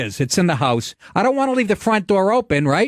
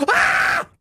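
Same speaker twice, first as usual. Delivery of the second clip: high unvoiced shriek; retold wife scream. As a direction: let out a sudden airy scream. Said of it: "I hit my wife going".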